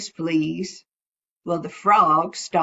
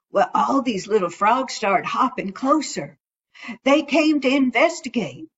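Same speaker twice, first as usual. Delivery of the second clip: plain reading voice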